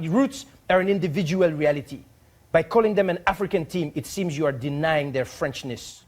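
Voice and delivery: put-on formal, emphatic French accent; stately French-accented letter reading. Ambassador lecturing on French identity, French-accented formality. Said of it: "read it how I hope he wrote it".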